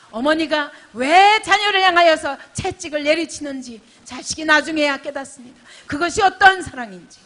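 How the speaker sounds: loud, high, ringing sermon voice; full-force preaching. Acted. Pastor pressing the congregation, preaching at full force. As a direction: preach it out to the whole hall.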